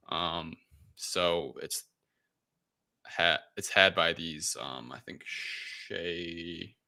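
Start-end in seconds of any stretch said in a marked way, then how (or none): none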